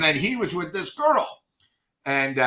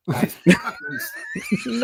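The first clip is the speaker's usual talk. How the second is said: stunned; much slower than usual. Retold or imitated. Stunned on learning it was Beck.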